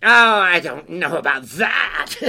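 loud, careless creature voice; fly-by-night's cry. Acted. Creature dismisses girl's worry, loud careless cry. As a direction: brush it off with a loud chuckle. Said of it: "cried the fly-by-night".

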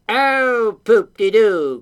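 loud, dismissive creature exclamation; fly-by-night's cry. A narrator's reading. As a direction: brush it off loudly as the carefree creature. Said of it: "cried the fly-by-night".

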